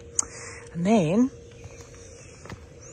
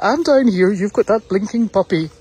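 a loud, higher mock voice for the jealous dog; voicing the dog; 4 semitones higher, 6 dB louder than usual. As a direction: speak for the sulking old dog. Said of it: "She's like".

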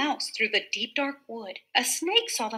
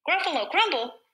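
higher-pitched, startled repeat; alarmed echo; 5 semitones higher, 3 dB louder, faster than usual. Acted. Gruffalo learning he may be eaten, alarmed. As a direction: echo "Gruffalo crumble" in mock alarm, falling at the end.

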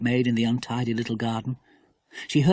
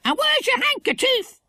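very high squawking bird voice; high-pitched parrot scolding; stern parrot. A narrator's reading. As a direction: demand it like a bossy parrot. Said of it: "she said sternly to the startled porter".

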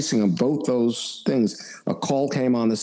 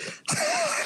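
high burst of laughter; 6 dB quieter than usual. Real, not acted. Admitting not interested.

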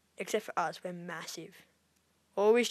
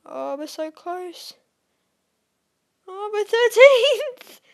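strained high, drawn-out squeal building louder; high-pitched excitement; 11 semitones higher, 12 dB louder, slower than usual. Real, not acted. Gamer desperate to make the leaderboard, high-pitched excitement. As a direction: stretch it out, rising into a loud high cry. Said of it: "if my voice is getting high pitched".

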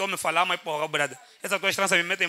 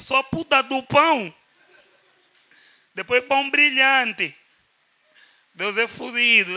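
mock sermon; 4 semitones higher, 4 dB louder, slower than usual. Mock preaching about bread.

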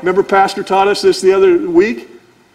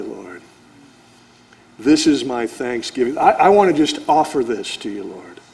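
tender prayer; 5 semitones lower than usual. Acted. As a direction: pray it gently, from love. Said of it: "Then we could say".

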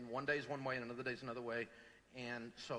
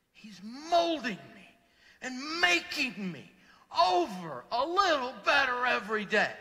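much higher and louder, slow at first, building preacher's cry; preaching climax; 10 semitones higher, 13 dB louder, slower than usual. Acted. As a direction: build to a soaring shout.

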